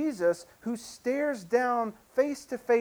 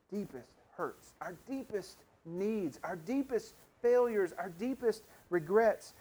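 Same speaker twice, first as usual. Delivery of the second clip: ordinary delivery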